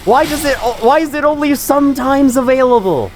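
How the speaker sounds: rising incredulous whine; exasperated questioning. Real, not acted. Baffled ranting about game drops.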